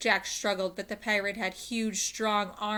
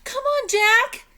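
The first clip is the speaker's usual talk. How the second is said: high, loud and slow urging shout; 14 dB louder, slower than usual. A narrator's reading. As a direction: yell for him to come on. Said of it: "Annie shouted".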